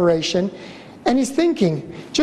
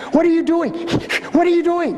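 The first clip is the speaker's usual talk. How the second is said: imitating angry wife; 7 semitones higher than usual. Wife confronting him in labor.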